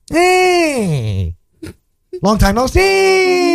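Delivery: high, long-held greeting call; impression of dad. Son imitating dad's greeting, high drawn-out call. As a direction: sing out the last word high and stretched. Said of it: "my dad says".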